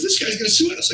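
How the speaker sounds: high-pitched mock panic; alarmed retelling; panicked. Club owner repeats lawsuit fear.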